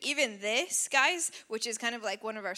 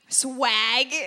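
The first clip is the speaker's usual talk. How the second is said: mock teen slang; 10 dB louder than usual. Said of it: "Who even knows what that means".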